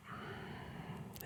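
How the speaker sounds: normal talking voice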